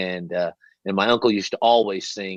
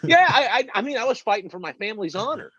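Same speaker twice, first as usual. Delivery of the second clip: laughing, pitched up high; 13 semitones higher than usual. Real, not acted. Man laughing about his schoolyard fight. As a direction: chuckle through the memory.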